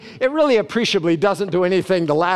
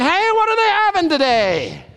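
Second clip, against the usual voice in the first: eager question; 9 semitones higher than usual. Pretend. Heaven-goer calling out eagerly.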